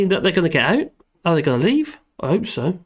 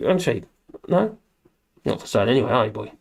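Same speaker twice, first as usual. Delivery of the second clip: ordinary delivery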